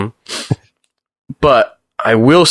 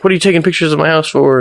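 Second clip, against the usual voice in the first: upset homeowner; 8 semitones higher than usual. Retold or imitated. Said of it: "the dude came out pretty upset".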